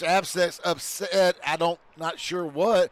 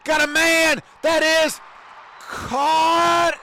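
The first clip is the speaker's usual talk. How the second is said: rising, louder play-by-play call of the catch; excited call; 10 semitones higher, 4 dB louder, much slower than usual. Real, not acted. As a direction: call the catch as it lands, voice jumping up. Live call of a big catch.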